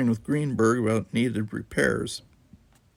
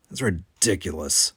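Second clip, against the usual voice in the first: ordinary delivery, nothing marked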